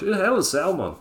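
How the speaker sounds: quick Scottish impression; mock Scottish accent. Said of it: "he said it in Scottish".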